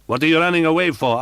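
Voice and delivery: furious. Acted. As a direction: chase her with fury. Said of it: "asked the furious voice".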